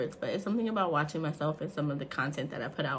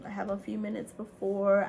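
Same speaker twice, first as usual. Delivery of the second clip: ordinary delivery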